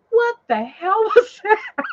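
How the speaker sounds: high, loud, drawn-out squeal; retold shock. Driver startled by sudden bang, retold shock. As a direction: squeal it slowly, half laughing.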